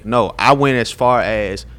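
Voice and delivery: normal talking voice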